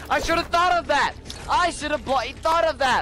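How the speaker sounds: frustrated. Acted. Frustrated after losing gun.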